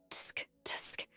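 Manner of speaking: breathy whispered tongue clicks; whispered tsk. A narrator's reading. Bystander tutting as Cheryl is humiliated, whispered. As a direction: tut under your breath.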